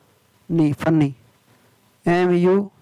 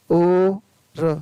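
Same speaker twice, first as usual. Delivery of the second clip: ordinary talk, nothing marked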